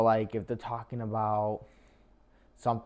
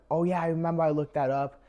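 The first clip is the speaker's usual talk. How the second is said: higher, louder, quick and eager; enthusiastic; 6 semitones higher, 4 dB louder, faster than usual. Acted. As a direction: sound keen and engaged. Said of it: "Showing interest could be saying stuff like".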